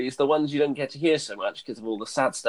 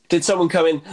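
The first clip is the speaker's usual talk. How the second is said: ordinary talk, nothing marked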